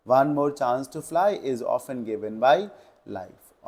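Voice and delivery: very slow reading of the right option; slow model answer. Shown as a demonstration. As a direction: dictate the correct answer. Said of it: "B सही answer है इसका". (सही उत्तर धीमे पढ़ना)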